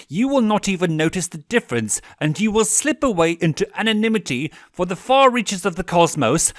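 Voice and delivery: furious demon prince; terrifying threat. A narrator's reading. Stolas threatens Veronica.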